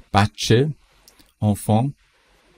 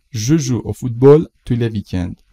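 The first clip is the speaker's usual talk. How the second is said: slow, louder, careful example sentence; slow model read; 6 dB louder than usual. Shown as a demonstration. Teacher models the sentence slowly and loudly for the class. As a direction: read it slowly and clearly aloud. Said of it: "teacher models slowly and louder".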